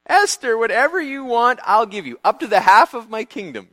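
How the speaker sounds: a king flushed with good feeling, showing off; grand booming generosity; generous king. Retold or imitated. Generous king offers reward.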